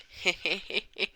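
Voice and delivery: high-pitched put-on giggle; acted giggle. Merlin has just announced twins, acted giggle.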